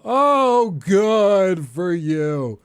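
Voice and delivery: loud, high sarcastic outburst, very drawn out. Retold or imitated. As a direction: imitate an actor's on-set rant.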